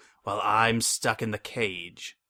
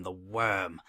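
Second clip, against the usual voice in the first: ordinary delivery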